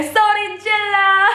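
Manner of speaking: high, drawn-out hype shout; mock performer's holler; imitated stage call. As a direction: call it out slowly and high to a crowd.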